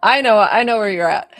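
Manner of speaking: fast, loud, high bursts of I know; eager, insisting she understands; excited reassurance. Real, not acted. Medium eagerly reassuring the client, loud and quick.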